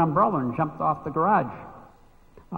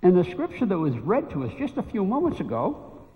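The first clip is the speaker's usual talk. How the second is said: preaching; 5 semitones higher than usual. Acted. Preaching builds in pitch.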